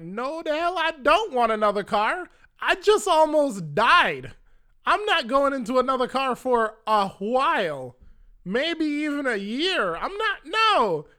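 high, loud and stretched, appalled at the offer; near-shouting, in disbelief, like you just cheated death; incredulous outrage. Retold or imitated. Caller to the insurance agent offering another car, in loud, high disbelief. Refusing a new rental car.

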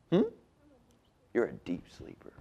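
usual speaking voice

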